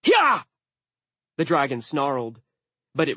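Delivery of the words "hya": sharp high loud cry; shooing yell. A narrator's reading. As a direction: shoo it off like an animal. Dwarf shooing the dragon.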